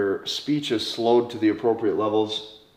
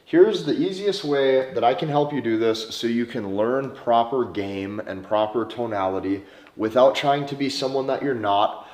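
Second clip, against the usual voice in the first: usual speaking voice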